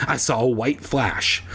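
plain reading voice

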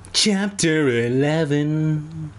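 stretched mock-dramatic heading; chapter announcement. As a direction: announce the chapter with theatrical slowness.

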